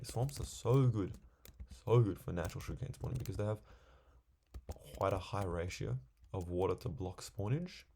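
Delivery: ordinary delivery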